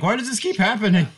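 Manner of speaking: high, baffled group complaint; mock exasperation. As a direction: act out a puzzled why-me. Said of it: "and we're like".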